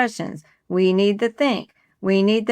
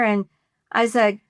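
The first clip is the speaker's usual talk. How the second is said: usual speaking voice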